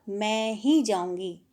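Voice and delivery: slow, with ही given the weight; drawn-out "मैं ही जाऊँगी" with weight on ही; stress on ही. Shown as a demonstration. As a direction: lean on ही to mean "only me". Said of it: "निपात का मतलब है बल डालना, जोर डालना".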